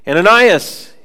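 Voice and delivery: calling a name out loud. Retold or imitated. The Lord calls Ananias in a vision, loud call. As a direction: summon him, pitched high and loud.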